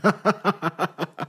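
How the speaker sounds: high, rusty, joyful laugh; a long out-of-practice belly laugh; old man's first hearty laugh in years; splendid laugh. Acted. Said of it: "it was a splendid laugh".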